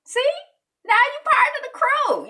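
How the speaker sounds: high loud celebratory call; excited welcome. Real, not acted. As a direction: shout the welcome with joy. Welcoming new members.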